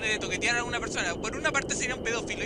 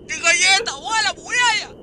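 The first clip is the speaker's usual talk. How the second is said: much louder astonished cry; loud surprised shout; 7 semitones higher, 11 dB louder than usual. Real, not acted. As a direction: shout it in disbelief. Exclamación de sorpresa. (grito de sorpresa)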